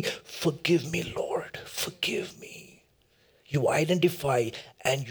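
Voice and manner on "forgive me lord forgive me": hushed, slow plea for forgiveness; penitent prayer. Acted. Repentant sinner asking the Lord to forgive, hushed prayer. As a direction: sink almost to a whisper, begging. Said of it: "Oh, tell God".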